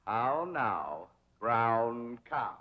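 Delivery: "How now, brown cow" with long, rounded vowels; diction drill. Shown as a demonstration. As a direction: draw out each word of "How now, brown cow", rising then falling on "How". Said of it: "Now we'll practice diction and intonation".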